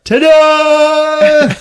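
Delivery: high, loud showy cry; mock gleeful reveal. Hank revealing himself behind the judges, mock gleeful. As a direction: sing out proudly, smiling, as a comic imitation. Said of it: "he's smiling and he's just like".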